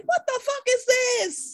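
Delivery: high-pitched, drawn-out disbelief; incredulous outburst. Retold or imitated. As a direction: recount it with exaggerated, high incredulity. Retells own outraged disbelief at news.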